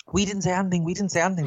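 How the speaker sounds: high-pitched repeated denial; imitated protest. Cinema lads denying it to their manager, high mimicked protest. Accused staff deny it to manager.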